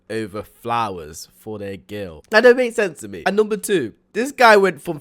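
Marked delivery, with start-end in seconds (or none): 2.3-3.2 louder and higher, can't believe it; baffled outburst. Real, not acted. Reacting to the gift card story, a loud baffled outburst. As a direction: blurt it out at the screen.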